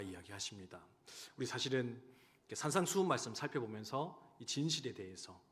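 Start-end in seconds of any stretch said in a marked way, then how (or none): none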